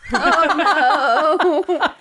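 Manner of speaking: amused outburst. Real, not acted. Amused reaction to skunk hat.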